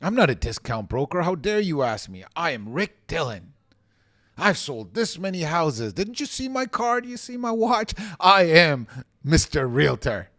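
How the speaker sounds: hammed-up offended realtor showing off; arrogant realtor. Shown as a demonstration. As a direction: puff yourself up like a big-shot agent. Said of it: "that's not the way you handle it".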